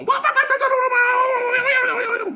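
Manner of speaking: high, loud screaming wah wah with a laugh; hammed-up scared wailing; mock terrified shriek. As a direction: shriek like the frightened husband seeing a ghost. Husband startled by wife waking.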